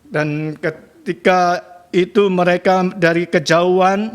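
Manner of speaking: ordinary talk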